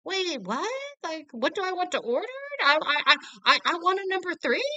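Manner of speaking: high, fast, flustered squeak; confused high voice. Retold or imitated. Mother suddenly ordering from the employee, high flustered squeak. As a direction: act baffled by your own order.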